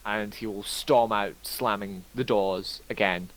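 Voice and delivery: ordinary delivery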